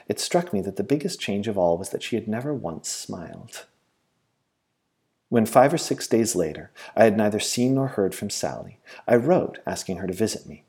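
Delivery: ordinary delivery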